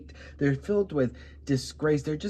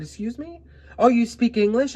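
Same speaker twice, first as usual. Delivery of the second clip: indignant retort; 8 semitones higher, 5 dB louder than usual. Retold or imitated. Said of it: "And I said".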